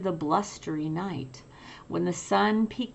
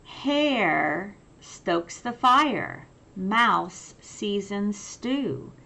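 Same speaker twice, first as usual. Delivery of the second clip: lively picture-book read-aloud; reading to children. A narrator's reading. Teacher reading a picture book to children, lively. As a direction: read it like bedtime for little ones.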